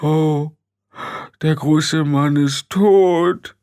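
hammed-up high wail; drawn-out comic grief; sobbing. A narrator's reading. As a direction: wail it slowly, pitched high. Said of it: "schluchzte er".

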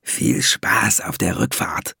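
whispered mock farewell, slow; dwarf whispers a parting jab to the ferryman; sly whisper. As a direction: whisper the taunt as he leaves. Dwarf mocking the scared ferryman.

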